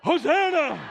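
loud, high crowd cheer; practice shout. Shown as a demonstration. Preacher leading the congregation in practice, full shout. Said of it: "let's all shout, "Hosanna".

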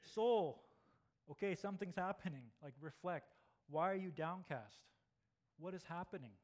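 urgent cry inward; calling to the soul. Acted. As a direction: shake your soul awake, urgent. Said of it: "It's like saying".

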